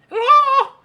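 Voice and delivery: high, loud sing-song hello; mock innocent hello. Answering a telemarketer's call to mess with them, squeaky loud hello. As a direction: act dumb and chirp it. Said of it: "I'll just sit there and go".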